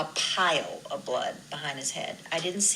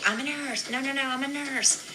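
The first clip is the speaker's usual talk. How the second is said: higher urgent announcing call; 9 semitones higher than usual. Retold or imitated. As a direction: call up from below to let them know. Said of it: "you can hear my voice in the video".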